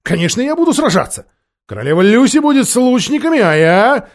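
put-on high, fast boyish protest; loud indignant outburst; crying out. A narrator's reading. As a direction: argue hotly, rushing the words. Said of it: "вскричал Корин".

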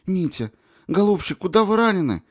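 high, anxious rush to the wounded man; frightened concern, voice lifted high; alarmed cry. A narrator's reading. Nurse rushing to wounded Mitya, alarmed and high. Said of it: "бросилась она к нему".

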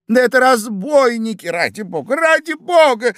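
high, desperate appeal; crying out. A narrator's reading. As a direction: beg loudly, leaping up in pitch. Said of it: "кричал Иван Андреевич". (отчаянный крик)

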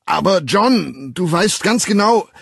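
high, loud, slow on John; bellowing with all his might. A narrator's reading. Bernard bellowing at John behind the door, high and loud. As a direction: shout it out to reach him through the door. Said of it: "wenn man aus Leibeskräften brüllen musste".

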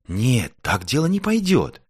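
high, slow, determined refusal; resolute. A narrator's reading. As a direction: put your foot down firmly. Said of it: "решительно сказала Звёздочка".

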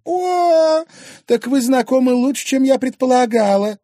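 very high, loud mock astonishment; sarcastic outrage. Jealous wife learning he knows the rival, sarcastic. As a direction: exclaim loudly with biting sarcasm.

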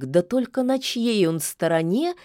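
ordinary delivery

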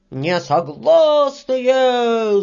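long drawn-out, high loud cry; crowd shout. Acted. All the little workers refuse together, shouting. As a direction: stretch it like a whole crowd calling. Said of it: "закричали все мужички".

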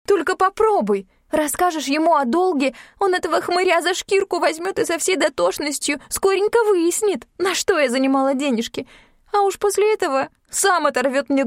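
alarmed warning. Acted. Yulka warns against telling her father.

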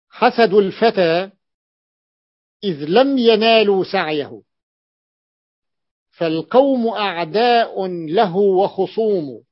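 slow, measured reciting of classical verse; lightly chanted classical Arabic poetry; poem recitation. Acted. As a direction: recite the lines with a rising lift.

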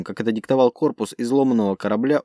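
plain reading voice

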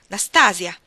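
loud call through the door. A narrator's reading. Countess calls the maid from the doorway, a loud call. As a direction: summon the maid from afar. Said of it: "l'aprì e chiamò".

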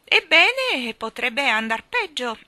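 high, loud squawk that slows down; shrill old lady cry. Acted. Miss Calico crying out to the children, high loud squawk. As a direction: cry out like a hen. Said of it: "gridò la signorina Calicò".

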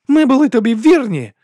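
high, loud, straining cry; anguished scream. A narrator's reading. Woman protests loyalty to the duke, screaming with anguish. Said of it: "закричала вона з надривом".

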